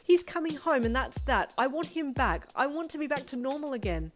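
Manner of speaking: high, loud stubborn outburst; heated pleading defiance; angry, tearful insistence. A narrator's reading. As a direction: lay down the law, upset. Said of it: "angry tears falling down her cheeks".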